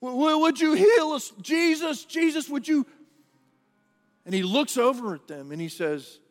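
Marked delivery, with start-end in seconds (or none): 0.0-2.8 much higher, louder desperate cry; pleading lepers. Retold or imitated. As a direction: beg Jesus to heal you. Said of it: "they were like".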